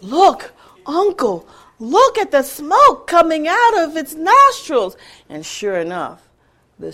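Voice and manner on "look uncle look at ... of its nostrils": put-on eager, higher and louder child's call; excited nephew. Nephew pointing out the dragon to his uncle, excited. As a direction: tug at your uncle's sleeve with excitement. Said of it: "said the magistrate's nephew".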